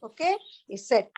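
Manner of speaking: ordinary delivery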